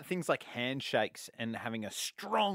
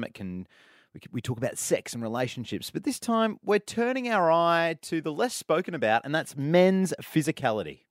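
ordinary talk, nothing marked

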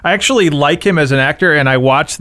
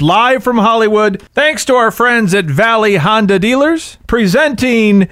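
big drawn-out awards announcer; slow, higher-pitched, building to the name; 7 semitones higher, slower than usual. Acted. Host introducing the film critic as a nominee, announcer style.

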